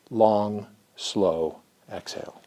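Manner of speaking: low, soft and slow; slow soothing. Shown as a demonstration. Therapist modelling the calm exhale: low, soft, slow. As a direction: breathe the words out gently.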